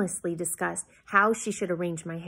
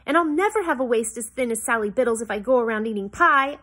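louder, higher, frustrated outburst; frustrated shout; 5 semitones higher, 7 dB louder than usual. A narrator's reading. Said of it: "I shouted in frustration".

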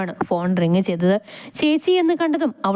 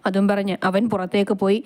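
plain reading voice

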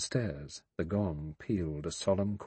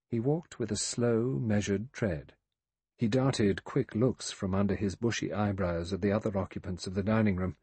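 usual speaking voice

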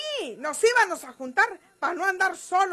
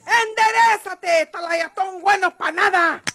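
higher, louder, slow commanding yell; furious shout; 4 semitones higher, 5 dB louder than usual. Acted. María Eugenia catches Gumaro and shouts at him. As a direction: bark the order with contempt.

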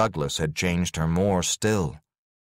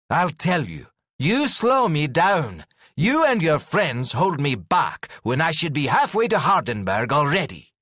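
harsh rebuke; 9 semitones higher, 3 dB louder than usual. Acted. Douglas harshly pushes her away.

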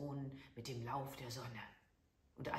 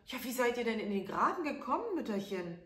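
put-on a touch higher, eager young prince; lightly voiced prince; 6 semitones higher, 6 dB louder, faster than usual. A narrator's reading. Prince asks the old woman how she fell, lightly voiced. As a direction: ask kindly, a bit quick. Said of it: "und fragte".

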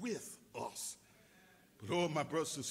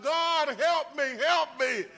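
crying out to God; 9 semitones higher, 11 dB louder than usual. Retold or imitated. Preacher voicing the believer's desperate loud plea. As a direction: cry help me upward like a desperate prayer. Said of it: "we're crying out to God".

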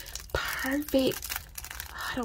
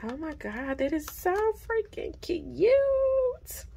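breathless adoration; 9 semitones higher, slower than usual. Real, not acted. Vlogger cooing over a cute item. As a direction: coo it out slowly.

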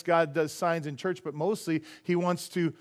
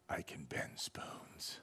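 very low, slow ominous whisper; spooky whisper; 21 dB quieter, much slower than usual. Retold or imitated. The spiritist boasting to the pastor, slow low whisper. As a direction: drag it out low like a cheap mystic. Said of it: "He goes".

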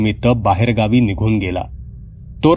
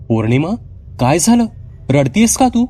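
put-on high, concerned child voice; a sister asking why she cries; worried girl; 7 semitones higher than usual. Sheena asks her crying sister what happened, worried.

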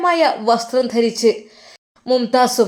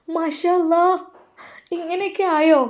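put-on higher, girlish wondering voice; a higher, girlish young wife amazed at the news; young wife's voice; 7 semitones higher than usual.

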